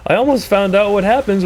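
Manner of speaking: high, giggly relieved laughter; laughing. Real, not acted. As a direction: laugh through the words. Laughs after near miss.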